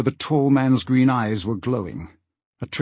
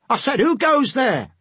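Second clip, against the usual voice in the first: high, insistent sailor voice; sailor's challenge; 13 semitones higher than usual. Acted. Sailor challenges Asta from right behind, high insistent call. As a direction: call it out high, demanding an answer.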